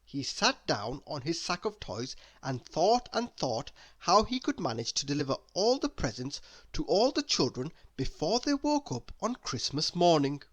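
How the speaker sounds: ordinary delivery, nothing marked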